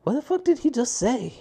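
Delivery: imitating his own confused reaction; baffled disbelief. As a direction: play the lost listener, incredulous. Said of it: "I was like".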